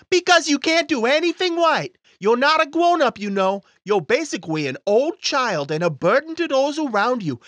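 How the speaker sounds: put-on high, loud cruel kid; a spiteful child attacking; little boy voice. Timmy voice insults host.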